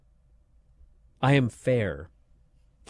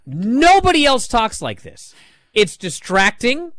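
normal talking voice

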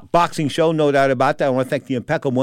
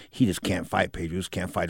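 quiet quote; 5 semitones lower, 8 dB quieter than usual. Retold or imitated. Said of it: "He goes".

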